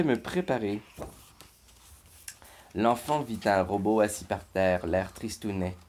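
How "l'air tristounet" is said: ordinary delivery, nothing marked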